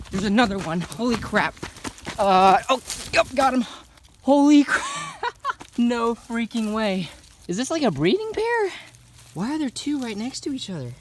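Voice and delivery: very high, loud disbelieving yells; ecstatic shouting. Real, not acted. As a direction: scream with joyful disbelief. Finds a second coachwhip.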